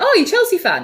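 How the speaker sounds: imitating an engineer, high and eager; high-pitched, friendly retold question; retold chatty Steve. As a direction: play the internet man perking up. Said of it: "And he's like".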